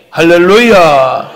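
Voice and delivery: loud, drawn-out hallelujah; preacher's call. Real, not acted. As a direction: call out to the congregation, stretching it long. Preacher calls out to congregation. (길게 외치는 설교자의 할렐루야)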